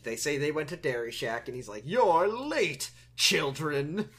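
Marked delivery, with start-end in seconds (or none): 1.9-4.1 booming, slow, hammy king; hammy stage actor. Retold or imitated. Voicing hammy King Ham.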